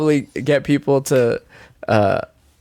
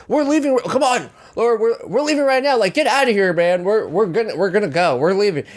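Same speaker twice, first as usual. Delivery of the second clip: defusing; 7 semitones higher than usual. Retold or imitated. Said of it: "started diffusing".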